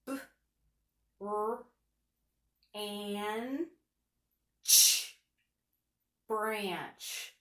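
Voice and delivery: each sound said apart, then blended; sounded out slowly. Shown as a demonstration. Teacher tapping out a word, slow and segmented. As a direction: sound it out piece by piece. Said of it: "Let's tap this one out".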